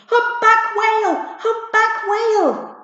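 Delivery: high, loud, slow code-word shout; urgent shout. Acted. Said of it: "Jody was shouting".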